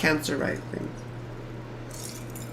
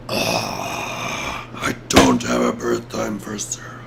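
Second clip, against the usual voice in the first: very low, creaky exasperated groan; groaning dismay; 4 dB quieter, slower than usual. Real, not acted. Streamer missing a birth time for a chart, groaning dismay. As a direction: groan it out softly, right down in the throat.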